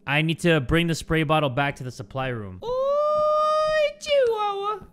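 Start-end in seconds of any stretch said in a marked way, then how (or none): 2.6-4.9 very high, drawn-out falsetto cry; comic high wail. Pretend. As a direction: squeal it out in mock dismay. Exclaims in very high put-on voice.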